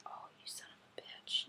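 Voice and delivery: sharp whispered swear under breath; whispered curse. Real, not acted. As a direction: curse quietly so nobody hears. Mishap mid video, swears under breath.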